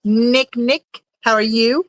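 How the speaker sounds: odd drawn-out greeting with rising endings; weird greeting. Real, not acted. Streamer greets Nick arriving in chat, weird drawn-out hello. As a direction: repeat the name oddly, each one lifting. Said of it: "Sorry, that was weird".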